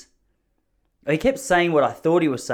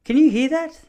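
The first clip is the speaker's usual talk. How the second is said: high, loud startled question; incredulous exclamation; 6 dB louder than usual. Real, not acted. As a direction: blurt it out in disbelief. Reacting to neighbour's noise interrupting podcast.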